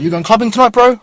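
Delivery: high, loud and pushy; loud street stranger. Retold or imitated. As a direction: fire the question up high and loud. Stranger asks about clubbing.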